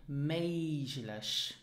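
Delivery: "möglich" with zh for the g; MAYZH-lish, slowly modelled "möglich". Shown as a demonstration. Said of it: "the G is pronounced more like a J".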